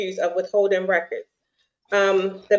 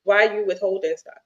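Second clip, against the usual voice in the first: ordinary delivery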